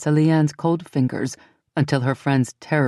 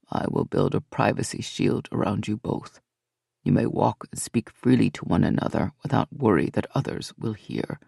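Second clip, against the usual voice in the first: lower, softer, calm and formal male voice; a bowing Fae offering a shield, gently; low calm Fae lord; courtly Fae man; 7 semitones lower, 5 dB quieter than usual. A narrator's reading. Fae Belliard speaks.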